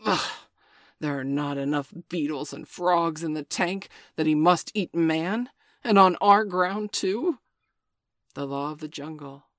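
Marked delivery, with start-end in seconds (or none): 0.0-7.4 loud, disgusted growl; disgusted anger. Acted. Father Wolf rages at the man-eating tiger, loud disgust. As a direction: rise to fury at the end.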